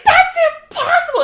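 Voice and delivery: a high, fast, laughing screech; mock screech. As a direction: shriek it like a hysterical diva. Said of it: "she's screeching, hysterical".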